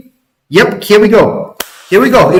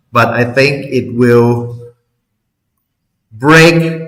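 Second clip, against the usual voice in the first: normal talking voice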